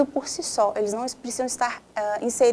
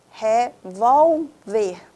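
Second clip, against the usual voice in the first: REVÓLVER very slowly split as re-vól-ver, louder; syllable by syllable; 5 dB louder, much slower than usual. Shown as a demonstration. Teacher running a stress game, Revólver syllable by syllable. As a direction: draw out each syllable of "Revólver".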